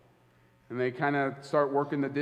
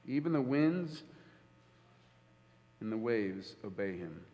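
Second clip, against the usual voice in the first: much softer and lower: even the winds and the waves obey him; hushed repeat; 5 semitones lower, 6 dB quieter than usual. Shown as a demonstration. Preacher letting the disciples' words sink in, hushed. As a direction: drop to a near hush on the repeat. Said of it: "preacher repeats the line".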